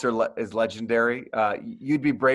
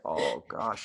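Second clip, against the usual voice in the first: much lower, softer mumbled "Oh gosh"; embarrassed quiet groan after the interview; deflated sigh; 10 semitones lower, 9 dB quieter than usual. Retold or imitated. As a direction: let it fall out of you in embarrassment. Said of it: "And I'm like".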